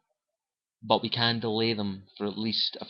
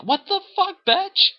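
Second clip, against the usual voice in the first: shrieked, much higher and louder; outraged shriek; 8 dB louder than usual. Retold or imitated. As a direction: scream it in disbelief at the betrayal. Outraged at base self-destruct.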